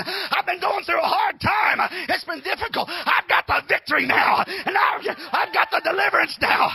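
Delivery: loud, rising preacher's cry of victory; preaching at full force. Acted. Preacher to the congregation, fired-up preaching. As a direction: preach it out with fire to the church.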